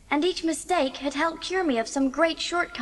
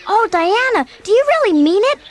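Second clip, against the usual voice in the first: louder, higher, breathless with delight; excited; 5 semitones higher, 7 dB louder than usual. Acted. Anne to Diana, thrilled at the town trip invitation. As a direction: burst out with thrilled disbelief.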